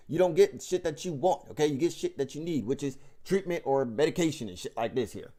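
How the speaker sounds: ordinary talk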